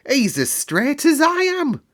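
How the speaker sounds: Yorkshire lad cheering his friend; cried out. Acted. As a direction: crow it with delight. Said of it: "cried Dickon".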